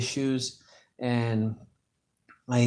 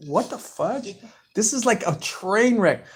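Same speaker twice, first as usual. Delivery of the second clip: high-pitched, drawn-out exasperation; exasperated disbelief; 10 semitones higher, 3 dB louder, slower than usual. Retold or imitated. Son recalling mom telling on him to his girlfriends, exasperated. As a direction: retell your dismay in a high, incredulous voice.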